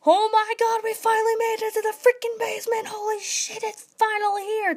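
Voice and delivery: a fan finally reaching the basement, screaming with joy; hyped fangirl squeal. Retold or imitated. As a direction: squeal it like the big reveal just dropped. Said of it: "and I was like".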